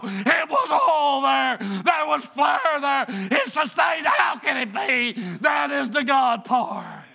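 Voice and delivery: loud, driving preacher's voice building the story; full-force preaching. Acted. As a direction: preach it out to the congregation.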